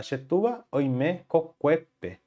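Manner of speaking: "Chetua oime kokuepe" said slowly, word by word; slow model sentence. Shown as a demonstration. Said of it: "gosto de colocar na frase correta". (frase modelo dita bem devagar)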